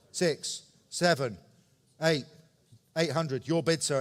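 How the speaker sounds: usual speaking voice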